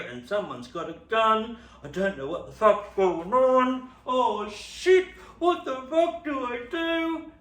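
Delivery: rising loud panicked rant. Retold or imitated. Panic hiding from gunman.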